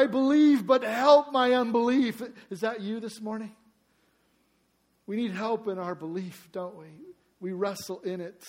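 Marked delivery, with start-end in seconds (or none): 0.0-2.2 high, loud pleading cry; desperate plea. Retold or imitated. Father begging Jesus for his child, loud pleading cry. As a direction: beg with breaking faith. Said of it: "the child cried out for healing".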